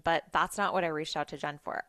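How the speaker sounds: ordinary talk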